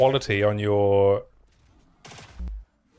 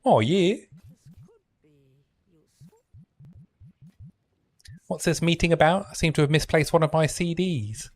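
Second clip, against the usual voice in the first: higher, hammed-up New Zealand accent; one more go at a Kiwi voice; bad Kiwi accent; 6 semitones higher than usual. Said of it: "I can't do a Kiwi accent".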